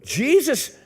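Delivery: normal talking voice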